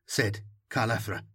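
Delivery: usual speaking voice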